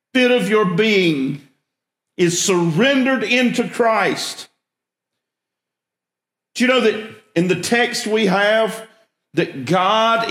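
rising sermon voice, building intensity; preaching. Acted. Pastor preaching Isaiah to his church, earnest sermon voice. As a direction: preach it to the congregation.

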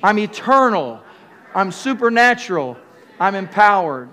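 loud emphatic declarations for the crowd to repeat; ringing call for the congregation to repeat; called-out affirmation. Shown as a demonstration. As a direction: proclaim each line so they echo it back. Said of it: "Say it".

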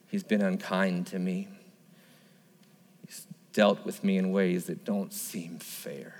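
low, soft, hopeless voice; sorrowful widow voicing her bitterness; despairing. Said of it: "can you feel the despair and hopelessness?".

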